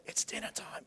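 a barely audible whisper; whispered dinner call. Retold or imitated. A parent whispering dinner time from far away. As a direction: whisper it and know they'll still come running.

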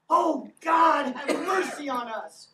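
loud, high anguished plea; desperate shouted prayer; cry for mercy. Real, not acted. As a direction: cry out to God from the gut. Crying out to God mid-sermon.

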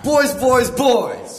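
high, loud taunting repeats; teasing taunt. Acted. Brother teasing sister about boys, loud high taunt. Siblings squabble over homework.

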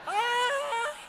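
long, high-pitched mock wail; imitated scream. Woman left behind by the car as he pulls off, mock screaming. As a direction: hold out a comic high scream. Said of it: "She's screaming".

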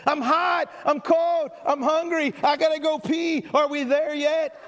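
put-on whiny child in the back seat; whiny kid. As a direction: complain like a bored kid. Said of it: "same thing as when I was a kid".

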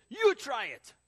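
high, very loud shouted order; angry screaming. Retold or imitated. Louis orders teen to taste omelet, angry scream. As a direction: bellow a challenge at the kid. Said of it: "So he's screaming at me".

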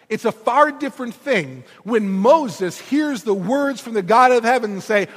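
ordinary talk, nothing marked

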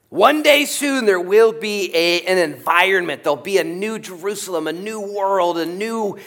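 high, loud, soaring proclamation; soaring preach. Real, not acted. Preacher crying out the hope of a new Jerusalem. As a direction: lift the whole room with the promise. Preacher rising to climactic vision.

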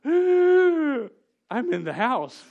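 high, loud, slow wail of dread; panicked realization. Retold or imitated. Boy hearing his mom's footsteps, a loud panicked wail. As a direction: cry out like a kid who knows he's caught. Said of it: "realizing to myself".

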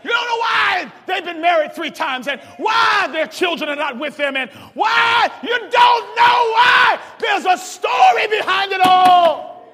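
loud, high preaching with fast runs; shouted sermon refrain; hollering preacher. Acted. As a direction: holler it at the congregation. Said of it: "why I holler when I preach".

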